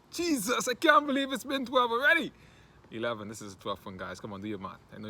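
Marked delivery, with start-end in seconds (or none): 0.1-2.3 high-pitched, incredulous outburst; exclaimed disbelief. Acted. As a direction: squeal it in mock shock at how time flew. Exclaims at reaching twelfth monologue.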